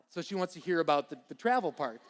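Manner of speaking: usual speaking voice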